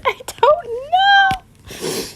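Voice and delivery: very high, drawn-out squeal; helpless whine. Retold or imitated. Retold answer to friend's mom.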